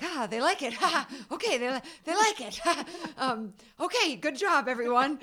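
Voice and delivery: high, loud, strained laughing relief; forced nervous laughter. Retold or imitated. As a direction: laugh nervously through the words. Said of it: "very forced, strained, like, nervous preview, preview laughter".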